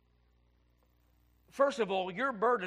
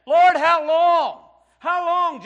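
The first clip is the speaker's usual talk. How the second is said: much louder, much higher, drawn-out cry to the Lord; anguished preaching cry; 10 semitones higher, 17 dB louder, much slower than usual. Acted. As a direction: wail it up to heaven. Preacher cries out to God.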